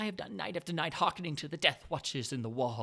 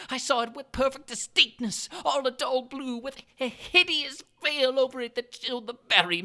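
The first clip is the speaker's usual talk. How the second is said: higher, louder, seething rage; growing fury; 7 semitones higher, 8 dB louder than usual. A narrator's reading. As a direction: let the fury boil over. Narrator rising into fury.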